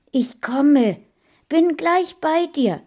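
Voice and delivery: high, loud urgent call. A narrator's reading. Friend calling to the boy from outside, urgent. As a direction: call across to someone in need. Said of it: "rief Mona".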